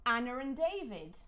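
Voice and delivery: "Anna and David" with an R between "Anna" and "and"; non-rhotic linking R after "Anna"; intrusive R. Shown as a demonstration. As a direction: slip an unwritten R in, "Anna-r-and David". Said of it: "what's called an intrusive R".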